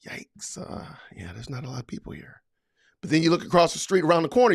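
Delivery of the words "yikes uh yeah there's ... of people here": muttered low and hushed, almost breathless; a sarcastic under-the-breath quote, low and very soft; mock hushed aside. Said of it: "You're like".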